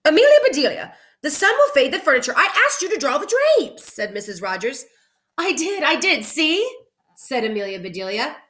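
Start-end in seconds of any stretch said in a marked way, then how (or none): 0.0-3.9 high, alarmed scolding lady; upset high Mrs Rogers. Acted. Mrs Rogers scolds Amelia about the sun-faded furniture, high and alarmed. 5.4-6.7 high, bright eager reply; eager Amelia voice. Acted. Amelia proudly shows her picture, in a high eager voice.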